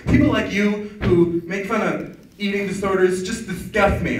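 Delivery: slow, high offended voice; mock indignant guy. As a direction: act out a prim offended guy. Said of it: "He's like".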